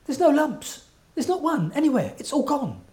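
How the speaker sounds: amazed lady. Retold or imitated. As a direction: lift the voice to show her amazement.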